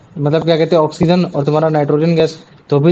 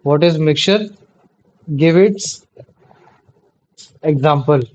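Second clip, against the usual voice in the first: phrase by phrase with long pauses; dictating; much slower than usual. Real, not acted. Teacher repeats the question for students writing, dictating in pieces. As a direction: read it out in pieces for note-taking.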